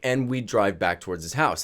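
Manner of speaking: usual speaking voice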